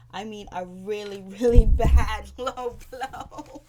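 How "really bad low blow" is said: loud, drawn-out burst of laughter mid-phrase; laughing. Real, not acted. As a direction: crack up loudly while saying it. Cracks up at the low blow.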